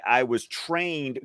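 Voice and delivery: normal talking voice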